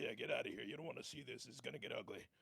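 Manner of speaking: ordinary talk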